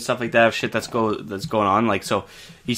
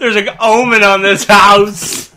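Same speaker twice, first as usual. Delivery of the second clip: mock ominous; 9 semitones higher, 10 dB louder, slower than usual. Mock spooky proclamation.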